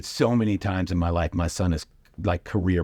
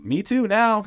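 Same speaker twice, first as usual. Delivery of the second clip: mock retort; 13 semitones higher, 4 dB louder, slower than usual. Comic retort, high.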